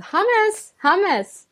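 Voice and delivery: high-pitched yelled note, re-enacted; mock yell. Coach re-enacting a note to an actor between takes, mock yelling. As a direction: call it out high, imitating an urgent yell. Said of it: "I just yelled".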